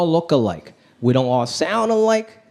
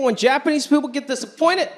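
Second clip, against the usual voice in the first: ordinary delivery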